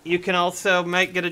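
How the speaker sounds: hammed-up telethon plug, stretching the end; hard-sell pitchman. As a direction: belt out the offer like an announcer. Hammed-up sales pitch for prizes.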